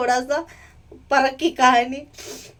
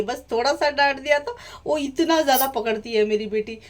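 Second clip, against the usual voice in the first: ordinary delivery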